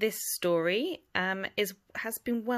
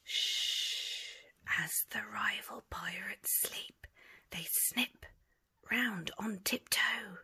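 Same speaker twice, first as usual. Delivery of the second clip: hushed sneaking whisper; 8 dB quieter than usual. A narrator's reading. Storyteller whispering as pirates sneak past sleepers. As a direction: tiptoe it in a whisper.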